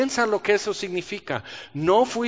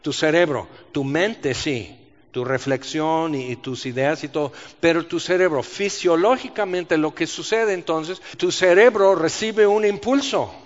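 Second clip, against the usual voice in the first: ordinary talk, nothing marked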